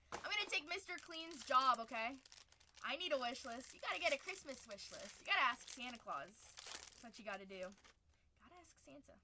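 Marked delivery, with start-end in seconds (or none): none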